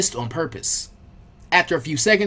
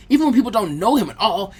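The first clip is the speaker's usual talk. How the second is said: usual speaking voice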